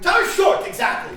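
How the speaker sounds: high, loud exclamation; hammy excitement; excited. Acted. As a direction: seize on it eagerly. Guru misheard, excited.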